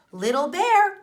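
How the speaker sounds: loud high call to her cub; mother bear calling her cub. A narrator's reading. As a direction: call out across the berry bushes. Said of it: "and she said".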